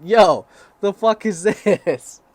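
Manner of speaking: loud, drawn-out mock outrage; big, slow, incredulous holler; outraged reaction. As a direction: yell it in exaggerated, high disbelief. Acted outraged reaction to porn.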